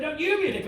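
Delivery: high-pitched swoop on "you"; bickering, stress on YOU. Acted. Back-and-forth row with dad.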